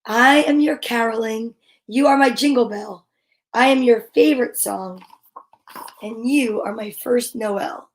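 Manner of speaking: gentle read-aloud of a rhyming picture book; storybook reading. Acted. Parent reading a bedtime rhyme to a child, gentle and unhurried.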